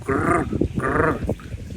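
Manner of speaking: playful growly monkey call; monkey language. Retold or imitated. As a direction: mimic the monkeys talking. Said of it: "Monkey language".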